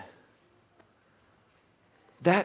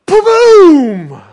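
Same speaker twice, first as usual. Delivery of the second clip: loud; 19 dB louder than usual. Pretend. Acts out cannonball splash.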